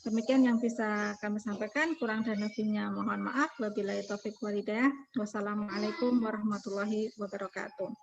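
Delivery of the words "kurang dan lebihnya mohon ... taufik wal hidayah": ordinary delivery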